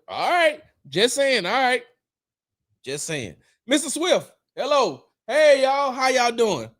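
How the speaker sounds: high, chummy greeting voice; mock greeting. People greeting Mister Swift, high friendly mock voice. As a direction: play the fawning well-wisher. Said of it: "They'd be like".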